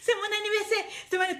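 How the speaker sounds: high sing-song fake sweetness, pitched well up; mocking scammer girl. Preacher mimics a woman scamming men, in a high sing-song. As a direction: coo it like a girl fishing for gifts. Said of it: "Dès que tu croises un gars".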